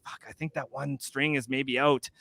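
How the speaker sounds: quiet worried thought; worried thought, hushed; nervous muttering. Retold or imitated. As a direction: mutter it under your breath.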